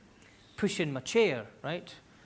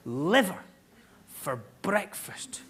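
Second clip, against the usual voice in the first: LIVER set apart, then a slow, hushed BREAKFAST; incredulous repeat; much slower than usual. Real, not acted. Comedian repeating Bavarian LIVER breakfast, slowly incredulous. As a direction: punch LIVER, pause, stretch BREAKFAST out in disbelief.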